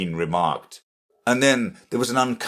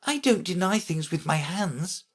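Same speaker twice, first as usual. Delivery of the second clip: lightly voiced, higher-pitched girl; objecting Alice; 9 semitones higher, 4 dB quieter than usual. A narrator's reading. Alice to the Red Queen, mild objection. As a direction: object with a slight lift in pitch. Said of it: "Alice objected".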